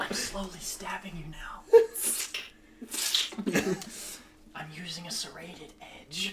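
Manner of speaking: breathy mock ASMR whisper. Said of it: "And stabbing you A S M R".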